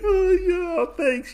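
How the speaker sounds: high-pitched, imitated shy thanks; bashful. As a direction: play a blushing, flattered reply. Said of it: "blush and whatnot".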